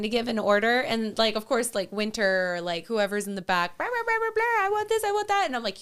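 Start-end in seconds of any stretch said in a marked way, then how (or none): 3.8-5.4 high-pitched mock whiny clamour; squeaky, hammed-up kid chatter; imitated demanding kids. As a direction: pester like kids in the back seat. Imitating kids in the back seat.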